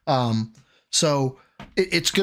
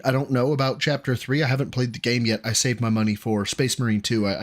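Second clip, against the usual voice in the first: usual speaking voice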